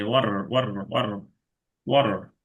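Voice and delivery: ওয়াটার with a relaxed soft d tap, like wora; a single tongue tap in ওয়াটার; soft flapped t. Shown as a demonstration. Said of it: "একটু নরম ডি".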